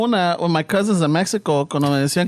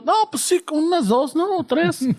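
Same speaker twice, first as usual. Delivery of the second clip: put-on higher naive kid voice; eager young self; 8 semitones higher than usual. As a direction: answer like a clueless eager boy. Young [name] eagerly ordering.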